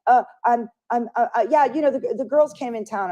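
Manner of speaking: imitating a stammering, high-pitched excuse; flustered evasion.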